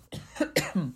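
real throat clearing, soft; chesty cough. Real, not acted. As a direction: clear the throat before going on. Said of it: "sorry, ik moet even hoesten".

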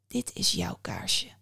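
slow, soft, ominous; hushed revelation. A narrator's reading. Mother Death shows the boy his own candle, hushed. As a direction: break the bad news slowly and quietly.

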